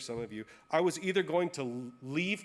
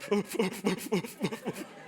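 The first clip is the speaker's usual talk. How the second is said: high laugh; 7 semitones higher than usual. Real, not acted. Laughing at himself mid-story.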